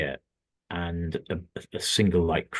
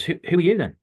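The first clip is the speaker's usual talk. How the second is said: retold intrigued question, pitched up high; fascinated; 8 semitones higher, 4 dB louder, faster than usual. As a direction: imitate someone eager to know who this is. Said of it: "he was fascinated by this".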